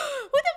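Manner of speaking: sharp high gasp of horror; mock shocked gasp. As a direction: gasp as if appalled by a recording. Retold shock at own recording.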